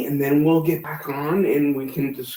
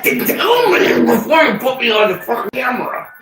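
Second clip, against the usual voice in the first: angry raised voice; 5 semitones higher, 5 dB louder than usual. Real, not acted. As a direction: yell it at her, fed up. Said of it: "He's fucking mad".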